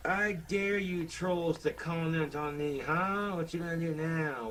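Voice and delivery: slow, high dummy voice taunting the trolls; taunting puppet. Acted. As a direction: dare them as the cocky puppet.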